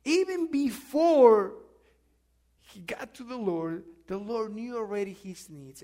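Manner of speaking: ordinary delivery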